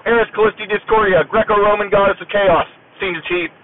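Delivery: high, stiff and deadpan; straight-faced military reply. Retold or imitated. Sailor explaining his dog tag to the senior chief, deadpan and formal. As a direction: recite it like a recruit at rigid attention. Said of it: "at the time it, I did".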